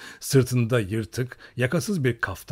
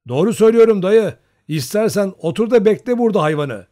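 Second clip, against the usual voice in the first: loud, raised teasing; mocking insistence; 9 semitones higher, 9 dB louder than usual. A narrator's reading. Young Cossack teasing the old man, mocking insistence. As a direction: insist loudly, pulling his leg. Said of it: "Nazarka alayla üsteledi".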